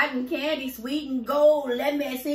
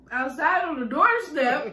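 slow, louder outraged echo; indignant disbelief; 5 semitones higher, 5 dB louder, slower than usual. Real, not acted. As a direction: repeat it in disbelief.

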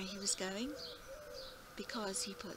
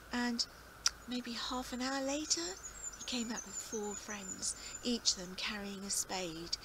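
usual speaking voice

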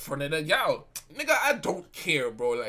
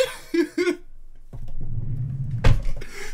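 high, loud wheezing burst of laughter; real laughing fit; 10 semitones higher, 5 dB louder than usual. Real, not acted. Storyteller cracking up at what happened in the room, real laughing fit.

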